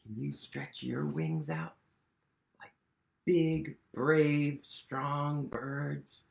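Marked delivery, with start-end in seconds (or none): none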